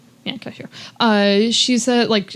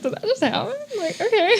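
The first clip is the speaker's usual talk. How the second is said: laughing disbelief; 11 semitones higher than usual. Retold or imitated. Friends' disbelief, laugh heard.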